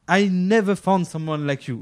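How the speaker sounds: slow, hammed-up English accent; English sung-out in a French speaker's accent, slowly; super English accent. Shown as a demonstration. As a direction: show off a would-be English accent. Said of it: "mon super accent anglais".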